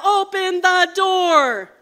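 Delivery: sharp shout through the door; yelling. Acted. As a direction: bark it out like a threat. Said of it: "he heard a scary voice yell".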